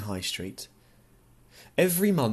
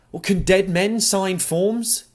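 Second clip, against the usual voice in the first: defiant challenge; 8 semitones higher, 6 dB louder, slower than usual. A narrator's reading. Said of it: "Steely green eyes staring unflinchingly".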